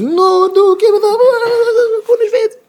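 very high, loud mock wail; high-pitched, exaggerated crying protest. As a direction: squeal it out like a hammed-up complaint. Mimics an upset letter writer.

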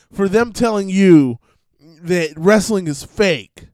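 ordinary delivery

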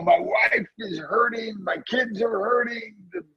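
rising, breaking plea that bursts into a shout at where are you; desperate prayer crying out, Lord where are you; anguished cry to God. Retold or imitated. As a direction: cry out to God in despair, close to tears. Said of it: "I had a breakdown where I'm like".

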